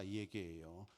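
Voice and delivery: ordinary talk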